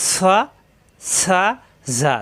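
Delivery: "স, সা, ঝা": heavy, thin, then buzzed za; short vowel demo. Shown as a demonstration. Teacher contrasts the three letters, short crisp syllables. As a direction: give "স, সা, ঝা" as separate short syllables. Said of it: "এখন কিন্তু উচ্চারণ তাড়াতাড়ি".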